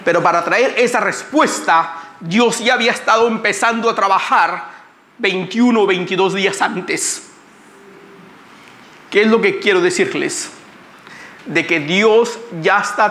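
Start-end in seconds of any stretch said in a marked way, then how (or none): none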